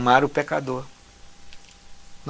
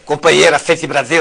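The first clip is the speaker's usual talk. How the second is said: louder political rally voice; Lula impression; 7 dB louder than usual. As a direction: call it out like a union leader at a rally. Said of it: "o Lula eu posso até, eu acho que daria pra fazer".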